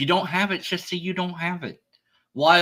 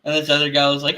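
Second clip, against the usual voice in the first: ordinary talk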